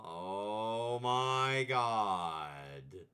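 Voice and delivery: long high drawn-out oh my god; stunned gasp. Real, not acted. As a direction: gasp it out in disbelief. Surprised by strange creature.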